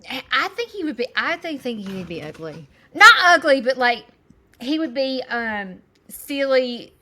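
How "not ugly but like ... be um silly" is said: louder, higher burst of giggly correction; excited correction. Real, not acted. Mother corrects herself about her husband, excited. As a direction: blurt it out, half laughing.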